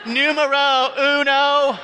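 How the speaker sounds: high, slow yell at the sign; yelled order. Retold or imitated. Learner yelling his order at a menu sign. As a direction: shout the number slowly at a sign. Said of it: "I'm yelling at a sign".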